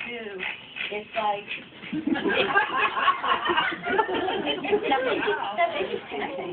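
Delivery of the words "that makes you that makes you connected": words squeezed out through real high laughter; can't stop laughing mid-sentence. Real, not acted. Author laughing at her own words to the audience.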